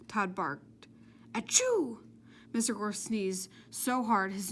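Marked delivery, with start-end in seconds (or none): 1.3-2.0 high loud explosive sneeze; big sneeze. A narrator's reading. Mister Gorf sneezes his nose off, a loud high sneeze. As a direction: sneeze it out hard.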